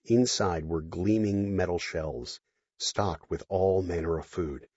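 normal talking voice

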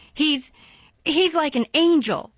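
high, loud heartfelt insistence; passionate praise. A narrator's reading. Mary defending Dickon, loud and passionate. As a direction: stumble then declare it.

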